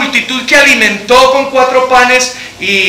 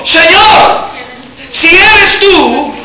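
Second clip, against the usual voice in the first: raised, high, drawn-out call; 6 semitones higher, much slower than usual. Acted. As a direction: Peter calling out to the Lord. Pedro llama a Jesús. (llamado en voz alta y alargada)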